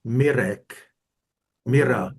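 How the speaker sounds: Mirek and Mira with Czech rolled R; Czech pronunciation. Shown as a demonstration. Learner says name in Czech for class, native pronunciation. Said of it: "say your name in Czech".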